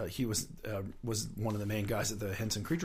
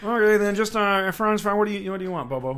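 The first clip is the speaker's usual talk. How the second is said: Muppet ordering voice; 11 semitones higher, 13 dB louder than usual. Retold or imitated. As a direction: order fries like a dopey Muppet. Muppet ordering at drive-thru.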